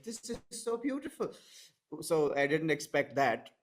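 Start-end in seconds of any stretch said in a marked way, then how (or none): 0.0-1.3 high, airy gushing commenter; mock a swooning fan; gushing mock quote. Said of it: "that are like".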